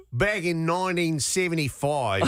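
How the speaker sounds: put-on Australian accent. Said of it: "it ends up turning into Australian".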